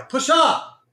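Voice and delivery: sudden high, loud yell; real burst of shouting; shouted urging. Real, not acted. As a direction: yell a command at a stubborn market. Yelling at the market.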